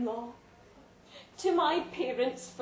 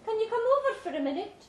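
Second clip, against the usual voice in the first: higher, quick, breezy invitation; mother-in-law's call; 10 semitones higher, 3 dB louder, much faster than usual. Retold or imitated. Mother-in-law phoning her son-in-law, higher and quick. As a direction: toss off a light, fast request.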